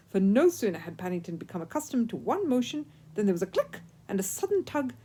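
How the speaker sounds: plain reading voice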